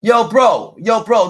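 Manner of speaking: loud shouted yo bro; calling out. Real, not acted. Challenging the rapper's fans, loud call-out. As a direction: shout him down across the room.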